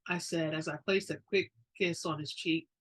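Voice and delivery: plain reading voice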